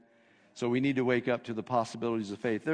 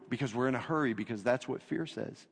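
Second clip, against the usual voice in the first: normal talking voice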